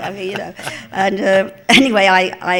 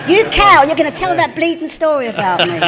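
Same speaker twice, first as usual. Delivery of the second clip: loud, high mock outrage; scolding impression, raised and loud; Joan; 7 semitones higher, 10 dB louder than usual. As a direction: hurl it like an indignant heckle. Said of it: "That was Joan".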